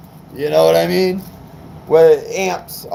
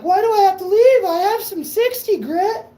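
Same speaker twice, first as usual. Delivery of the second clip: much higher put-on voice; mock whiny self-quote; 13 semitones higher than usual. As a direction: squeak it out like a whiny version of yourself. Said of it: "But I'm like".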